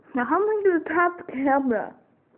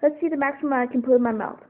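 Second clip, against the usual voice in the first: normal talking voice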